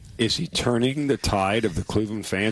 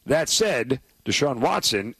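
ordinary delivery

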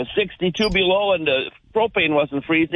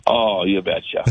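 hammed-up Minnesota accent, pitched lower; 7 semitones lower, slower than usual. Farmer hams up his Minnesota accent for the radio host. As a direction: lean into a playful Minnesota accent. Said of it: "you embody that Minnesota accent so well".

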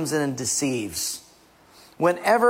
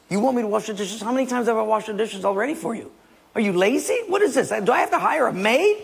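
higher, fast complaining husband; indignant husband rant; 5 semitones higher, faster than usual. Acted. Husband complaining to his wife about washing dishes, indignant. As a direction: huff at the wife about the chore.

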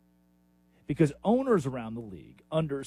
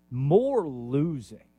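dry sarcasm; 2 semitones lower, 3 dB louder, much slower than usual. Real, not acted. Sarcastic punchline after pause.

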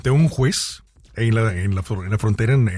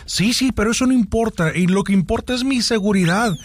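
pitched-up dismissive lady; mock haughty woman; 7 semitones higher than usual. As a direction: brush him off in her high voice. Woman dismisses his point.

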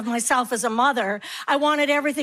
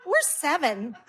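put-on small kids' voice; 4 dB quieter, slower than usual. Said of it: "they're in the back like".

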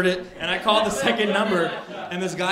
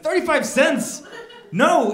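higher, louder, slowed disbelief; stunned echo of the cashier's price; incredulous; 9 semitones higher, 6 dB louder, slower than usual. Retold or imitated. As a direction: repeat the price back in shock.

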